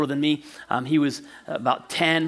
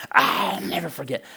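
mock-seething vow, softer; imitated anger; 5 dB quieter than usual. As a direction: play the mad brother holding it in. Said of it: "he's so mad".

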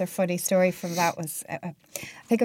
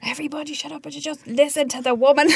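higher-pitched imitation of someone silencing a rowdy group; mock telling-off; 7 semitones higher than usual. Bandmate ordering giddy boys backstage to listen, mock telling-off. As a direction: call the unruly lads to order, playfully.

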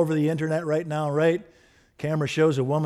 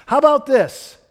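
excited exclamation; 10 semitones higher, 8 dB louder than usual. Real, not acted. Preacher posing a new idea.

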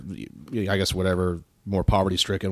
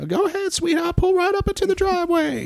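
very high falsetto woman calling out; sweet-talking woman. Retold or imitated. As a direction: coax him in, high and sugary. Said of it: "this woman is yelling from a window".

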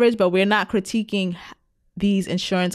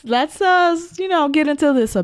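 higher, louder hype for the segment; excited; 9 semitones higher, 4 dB louder than usual. Real, not acted. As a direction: pump up the segment intro. Hyping up the pitch segment.

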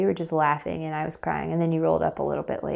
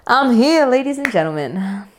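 loud, higher showman's announcement; mock announcement; 7 semitones higher, 10 dB louder than usual. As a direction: proclaim it like a ringmaster. Mock announcing she survived.

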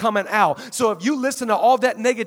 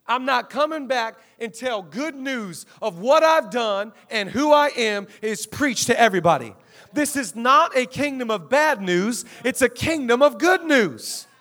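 slow, forceful pulpit proclamation; weighty preacher's declaration; emphatic preaching; 2 semitones higher, 5 dB louder than usual. Real, not acted. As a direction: declare it slowly, building in volume.

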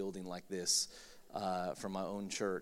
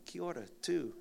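Kiwi greeting; 8 semitones higher than usual. Real, not acted. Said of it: "Is there any Kiwis here".

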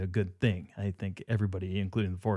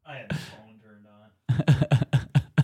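whispering; 3 semitones higher, 5 dB louder, much slower than usual. Real, not acted. Whispering off-mic aside.

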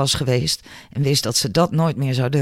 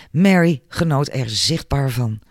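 ordinary delivery, nothing marked

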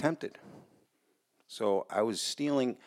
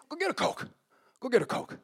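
mobster's order; 14 semitones higher, faster than usual. Retold or imitated. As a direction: imitate an insistent boss sending a kid off. Retold mobster orders him around.

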